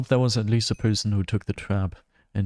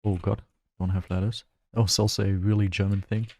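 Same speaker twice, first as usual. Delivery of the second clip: usual speaking voice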